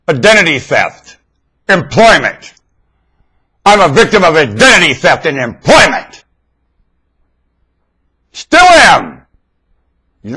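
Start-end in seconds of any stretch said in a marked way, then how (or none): 0.1-9.3 fiery preaching. Real, not acted. Preacher demanding answers from congregation, fiery preaching. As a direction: drive each question home to the crowd.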